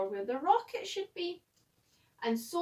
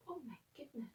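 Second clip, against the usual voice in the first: hushed, breathless aside; whispered aside; 14 dB quieter, much faster than usual. Real, not acted. Narrator whispering a shocked aside to the listener.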